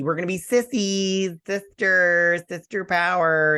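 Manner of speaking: high, hammed-up girl-power gushing; exaggerated sisterhood rallying cry; mock sisterly enthusiasm. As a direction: gush mockingly, building into a cheer. Mocking a character's sisterly bonding speech.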